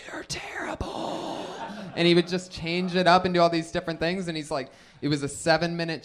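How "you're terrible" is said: slow unvoiced heckling whisper. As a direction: breathe it out slowly like a cruel secret. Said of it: "he would just hear".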